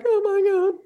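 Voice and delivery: high-pitched tearful re-enactment; mock crying. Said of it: "I just started crying".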